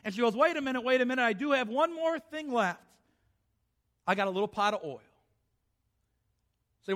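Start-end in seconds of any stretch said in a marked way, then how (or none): 0.4-5.0 sudden realization. Acted. Widow realizes again.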